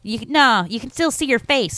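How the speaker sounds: imitated character's comeback; mock movie retort. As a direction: act out the put-down loudly. Acting Rizzo's retort in movie.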